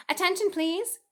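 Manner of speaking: high, loud call for attention; loud and firm. A narrator's reading. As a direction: announce it to the whole barn. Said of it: "in a loud, firm voice".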